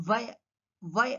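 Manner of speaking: "వయ" as via, teeth on bottom lip; V sound. Shown as a demonstration. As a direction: start "వయ" with V. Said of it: "just inside the bottom lip".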